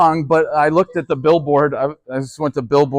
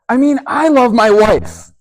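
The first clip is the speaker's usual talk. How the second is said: louder and higher, stress on I; emphatic declaration; 7 semitones higher, 6 dB louder than usual. Real, not acted. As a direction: declare it firmly, leaning on the first I. Youth pastor insists on his love.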